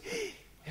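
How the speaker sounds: inspiración aguda y suave de sorpresa; jadeo de asombro. Acted. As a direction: finge un "¡ah!" de revelación, agudo y contenido. Reacción ante una frase del pastor. (grito ahogado de asombro)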